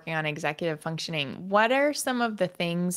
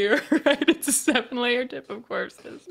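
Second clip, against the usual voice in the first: laughing; 4 semitones higher than usual. Real, not acted. Laughing joke.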